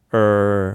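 "うー" as a long er with tongue curled; level, sustained American-style vowel. Shown as a demonstration. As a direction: hold an r-coloured "うー". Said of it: "舌を丸めるんですね".

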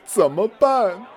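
high, slow pleading whine; whiny worried. Acted. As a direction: beg for a fix, whining. Acted skit worried question.

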